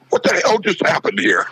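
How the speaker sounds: high-pitched baffled question; retold bewilderment. Security guy questioning the host afterwards, high-pitched bewilderment.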